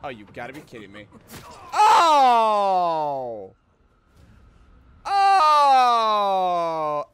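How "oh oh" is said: long, loud falling "oh"; dismayed yell. Real, not acted. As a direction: wail it out loudly, sliding down. Reacts to being hit in game.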